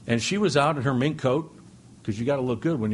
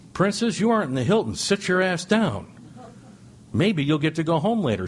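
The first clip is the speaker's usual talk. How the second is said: jailer scolding a prisoner; 5 semitones higher than usual. Retold or imitated. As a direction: bark it down at the spoiled prisoner. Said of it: "the jailer walks over and says".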